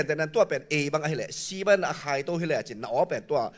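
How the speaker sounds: ordinary delivery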